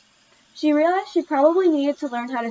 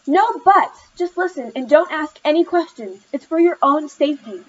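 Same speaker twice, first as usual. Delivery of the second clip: high, loud and insistent; scolding mother; 5 semitones higher, 5 dB louder than usual. A narrator's reading. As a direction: shut down the objection firmly. Mother silences daughter's objection.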